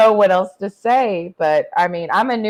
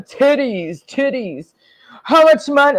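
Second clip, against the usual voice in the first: higher, eager mock voice of a horny buyer; mock drooling buyer; 6 semitones higher than usual. Mocking a horny guy begging for nudes. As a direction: pant for it like a drooling fool.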